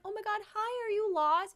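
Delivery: cooing at a lost Chihuahua, high and sweet; baby-talk to dog. Retold or imitated. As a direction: squeal a sweet hello to a tiny dog. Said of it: "And I was like".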